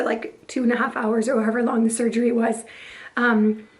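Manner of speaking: ordinary talk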